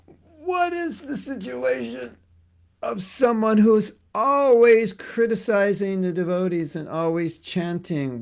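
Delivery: echoing the questioner's question in a hammed-up high voice; mock pondering. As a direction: repeat the question like a puzzled sage. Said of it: "Oh, great question".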